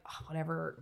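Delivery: usual speaking voice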